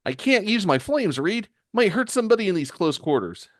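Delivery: put-on high-pitched young hero voice; Johnny. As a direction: pitch it up high for the hothead teammate. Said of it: "Johnny tackles him from behind and says".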